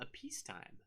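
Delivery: high, very soft, delicate; hushed. Acted. Author teasing the next book's setting to viewers, hushed. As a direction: say it softly and high, with dramatic suspense. Dramatic contrast with war.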